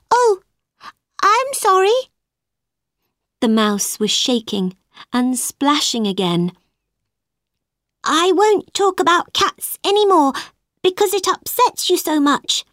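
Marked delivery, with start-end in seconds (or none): 0.1-2.0 sharp gasp, then high dismayed apology. Acted. Alice upsets the mouse again. 8.0-12.6 high-pitched, hurrying promise; quick reassurance. A narrator's reading. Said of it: "said Alice quickly".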